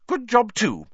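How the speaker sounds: high, loud motherly briskness; lifted, no-nonsense tone; brisk. A narrator's reading. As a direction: cut in, all business. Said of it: "said Missus Weasley briskly".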